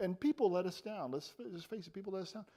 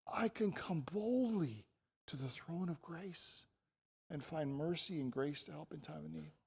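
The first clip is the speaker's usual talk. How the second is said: hushed awe; 7 dB quieter than usual. Real, not acted. Preacher marvelling at the throne of grace, hushed. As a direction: let "boldly" sink in quietly.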